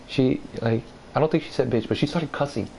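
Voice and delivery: ordinary delivery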